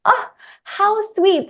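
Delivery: high, melting "oh"; cooing at how sweet it is; touched. Retold or imitated. Teacher moved by a child's news, touched.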